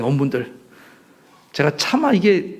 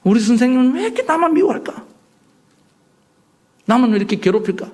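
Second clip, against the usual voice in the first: higher, whiny student's complaint; sulky student; 6 semitones higher than usual. Acted. Preacher voicing a student sulking about his teacher, mildly whiny. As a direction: sulk about the teacher picking on you.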